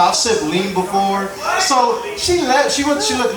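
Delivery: ordinary talk, nothing marked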